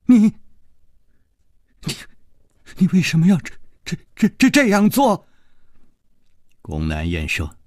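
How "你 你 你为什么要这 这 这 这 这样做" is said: stammering, rising higher on each 这; halting and shaken, climbing in pitch; trembling voice. Acted. Wounded woman stammers in fear at her attacker. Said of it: "她颤声说".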